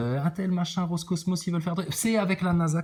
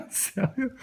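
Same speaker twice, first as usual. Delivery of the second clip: high incredulous laugh through words; mock him while laughing; laughing mockery; 5 semitones higher than usual. Real, not acted. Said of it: "ce ton d'hôtesse de l'air".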